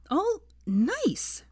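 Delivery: high, mocking sneer. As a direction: spit it back at him with scorn. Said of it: "she sneered".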